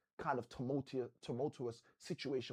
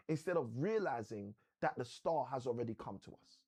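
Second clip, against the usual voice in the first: ordinary delivery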